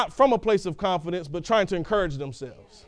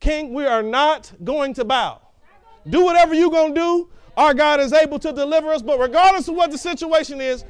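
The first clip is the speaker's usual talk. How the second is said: loud, higher, defiant declaration to the king; bold defiance; 6 semitones higher, 5 dB louder than usual. Retold or imitated. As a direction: plant your feet and defy the king. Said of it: "took a bold stance in front of the king".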